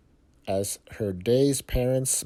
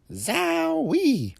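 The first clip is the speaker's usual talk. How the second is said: very high delighted yelp; a child struck by a stench, amazed; excited exclamation. Acted. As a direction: squeal of astonishment. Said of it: "said Ada".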